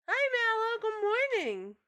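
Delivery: high, bright pet-talk hello. Real, not acted. Streamer greeting her cat in high baby talk.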